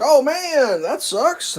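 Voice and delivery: high, loud mock sympathy; imitating seller. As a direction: ham up his casual pity. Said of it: "He's like".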